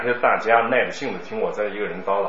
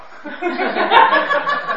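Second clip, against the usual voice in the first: high laugh; 5 dB louder than usual. Real, not acted. Teacher laughs at question.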